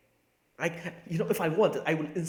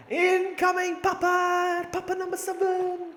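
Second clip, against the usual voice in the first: very high, drawn-out silly call; mock announcement; 6 dB louder, slower than usual. Player announcing the incoming Papa, in a high, drawn-out mock call. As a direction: call it out slow in a squealing falsetto.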